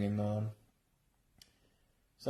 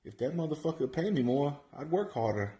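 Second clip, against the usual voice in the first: usual speaking voice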